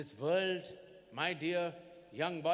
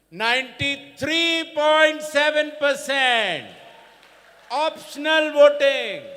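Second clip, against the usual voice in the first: louder and slower, hammering the figure; emphatic loud; 11 semitones higher, 16 dB louder, slower than usual. Real, not acted. Election chief booming the record turnout to students, proudly loud. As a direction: proclaim the number with pride, drawn out.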